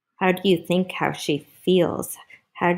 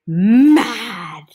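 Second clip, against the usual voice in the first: loud, drawn-out growly emphasis; mock anger; 2 semitones higher, 8 dB louder than usual. A narrator's reading. Reader tells children she gets mad, loud mock anger. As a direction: blurt it out in storybook fury. Story word acted out as anger.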